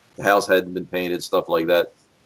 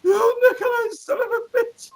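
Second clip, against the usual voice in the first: very high mock-tearful cursing; the Iron Sheik. Robbed roommate curses his partner, imitated high wail. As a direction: squeal it as a caricature of an outraged wrestler. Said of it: "he looked at him".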